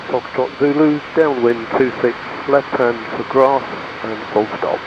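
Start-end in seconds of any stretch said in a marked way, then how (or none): none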